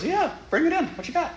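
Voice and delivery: high, eager grandmotherly welcome; welcoming grandma. Pretend. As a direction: open your arms and pull it in, grandma. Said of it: "how Grandma just goes".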